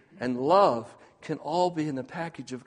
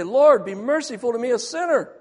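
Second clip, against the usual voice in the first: high, loud cry for mercy; desperate plea; 7 semitones higher, 7 dB louder than usual. Retold or imitated. Sinner on his knees begging God, loud high plea. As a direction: beg the Lord from your knees, raised and loud. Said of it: "fall on my knees and say".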